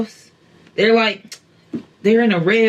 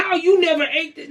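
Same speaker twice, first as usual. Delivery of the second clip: high-pitched, incredulous mock complaint; put-on indignant fan, pitched way up; mock mad commenter; 9 semitones higher than usual. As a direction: imitate someone scandalized by your opinion. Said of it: "people get so mad when you have an opinion".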